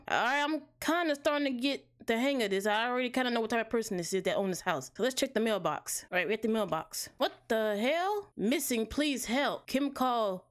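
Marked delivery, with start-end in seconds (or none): none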